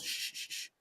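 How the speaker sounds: soft, voiceless shushing; hushing. Acted. Farmer warns the crane the snake can hear, hushing softly. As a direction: shush them under your breath. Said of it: "you have to whisper it in my ear".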